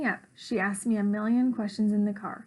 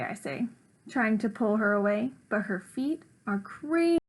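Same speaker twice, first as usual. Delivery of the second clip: plain reading voice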